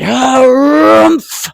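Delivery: big trumpeting elephant harumph, loud. Acted. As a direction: blast it out like an angry elephant. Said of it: "And he said".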